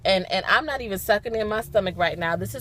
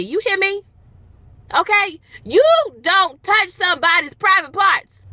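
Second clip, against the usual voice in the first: usual speaking voice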